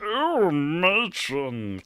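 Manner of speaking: slow, leering "Oh, matron" with a falling tune; a mock saucy leer, drawn out; lightly voiced Carry On lech; Carry On innuendo. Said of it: "Carry On Camping".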